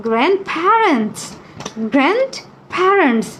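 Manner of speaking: GRANDPARENTS drawn out very slowly; slow dictation. Shown as a demonstration. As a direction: dictate it word by word, stretched out. Teacher dictates the word to write. (ধীরে ধীরে টেনে বানান লেখানোর মতো বলা)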